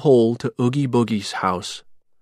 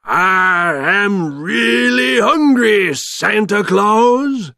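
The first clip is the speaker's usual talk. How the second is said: high, loud, very drawn-out villain voice; big slow bogeyman taunt; hungry monster; 9 semitones higher, 6 dB louder, slower than usual. A narrator's reading. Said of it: "he said".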